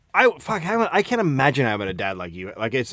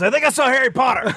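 higher, confused, half-awake voice; groggy dad; 11 semitones higher, faster than usual. Pretend. Mocking a friend's dad waking up confused. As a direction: play him waking up dazed.

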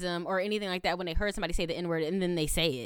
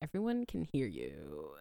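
low, quiet imitation of a warning; hushed warning; 9 semitones lower, 9 dB quieter than usual. Fellow gamer quietly warns the racer on a hot mic, hushed. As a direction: say it softly and low, as an awkward aside.

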